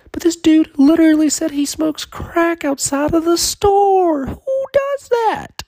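very high, loud incredulous voice; high comic outrage, slowing at the end; squeaky disbelief. Pretend. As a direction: screech it in disbelief. Disbelief at crackhead story.

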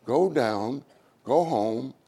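ordinary talk, nothing marked

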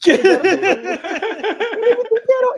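high squealing laugh through the words; giggling disbelief. Retold or imitated. Retelling flirty dance, laughing.

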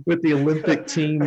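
trying to get back on script while amused; stifled laughter. Real, not acted. Host naming Kevin Love to the Olympic squad, stifled laughter. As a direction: talk through a laugh.

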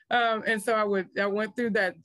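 normal talking voice

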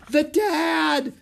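high, loud yell of alarm; girl yelling at a looming snake, high and loud. Acted. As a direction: shout it out as the giant cobra rears. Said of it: "yelled Annie".

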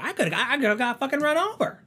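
hammed-up shrill complaint; mock outrage. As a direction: squeal it like the woman nearly hit. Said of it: "And she's like".

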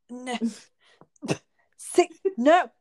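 high, loud, sputtering mock meltdown; melting-down hitchhiker. Hitchhiker rejecting six-minute abs, acted-out meltdown. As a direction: imitate a man coming unglued, pitch jumping up. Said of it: "he just seemed like he was melting down".

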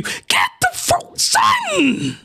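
high, strained outraged squeal; exasperated shriek. Real, not acted. Ranting at the hero who saved nobody, high shriek. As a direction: screech at the fool in disbelief.

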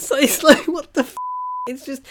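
high, loud disbelief through a laugh; mock distraught. Viewer reacting to hero shot down, mock distraught. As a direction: replay an upset outburst, half laughing. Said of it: "So then I started crying again".

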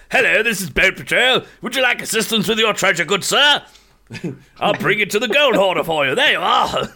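a lightly hammed posh British helper; posh British accent. Greeting players in game chat in a mock posh British accent. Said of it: "these very posh British accents".

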